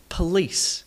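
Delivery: police as p'LEECE; vanishing schwa. Shown as a demonstration. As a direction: let the first vowel of police almost disappear. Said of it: "It almost disappears".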